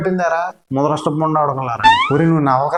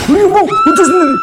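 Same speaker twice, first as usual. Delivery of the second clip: panicked scream; 6 dB louder than usual. Acted. The anaconda arrives.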